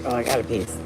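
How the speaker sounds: a hint of a proud puppy, murmured low; voicing the dog. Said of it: "She's like".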